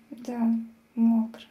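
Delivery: ordinary delivery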